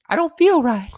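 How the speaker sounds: hammed-up high whine; mock fretful squeak. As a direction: squeak it out like a nervous wreck.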